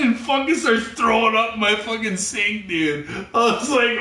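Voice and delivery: ordinary talk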